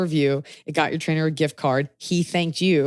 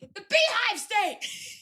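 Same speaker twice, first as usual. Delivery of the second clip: much higher, slow and softer teasing squeal; mock squeal; 4 dB quieter, slower than usual. Teasing the announcer again, high slow mock squeal. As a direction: pipe it up high like a joke. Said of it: "I'm screaming in his ear".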